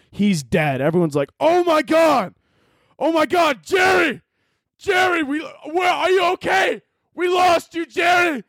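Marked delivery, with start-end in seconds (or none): 1.4-8.4 much higher, shouting his name in panic; panicked squirrel screaming. Acted. Squirrels screaming over their friend Jerry, shot dead, high panicked shouts. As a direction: shriek for your fallen buddy.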